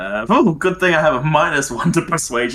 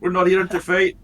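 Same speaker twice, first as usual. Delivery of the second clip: put-on higher-pitched Dwarvish accent, amused; 7 semitones higher, 3 dB louder than usual. Player telling guards there's no fight, Dwarvish accent. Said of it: "We'll go with the Dwarvish one".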